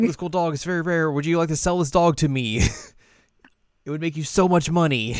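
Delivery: excited Riko. Retold or imitated. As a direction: rattle off with eager excitement. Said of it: "and she's like".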